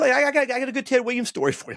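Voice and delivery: imitating the fan bursting to share his story; excited. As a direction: rush it like you can't wait to tell. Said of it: "he goes".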